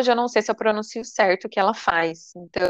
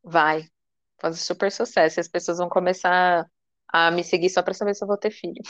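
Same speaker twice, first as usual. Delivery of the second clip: ordinary talk, nothing marked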